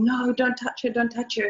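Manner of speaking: mock yell to keep her back; retold yelling. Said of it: "the people were yelling and saying".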